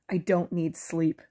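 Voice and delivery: slow, firmly bitten-off denial; snapped. Acted. Bo snapping at Archie, slow and firm. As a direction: push back, refusing the fog of sleep. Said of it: "I snapped".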